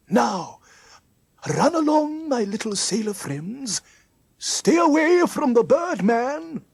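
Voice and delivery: high, loud, sing-out character voice; Mister Trim sending children off. Acted. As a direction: call out a send-off to the children.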